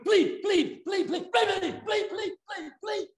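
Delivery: high repeated bleep noises, fading; imitated censor bleeps. Preacher mocks the bleeped swearing, high bleeping. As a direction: mimic the TV bleep tone over and over. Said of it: "they bleeped it".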